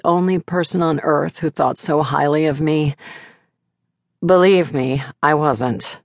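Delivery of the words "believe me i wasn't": usual speaking voice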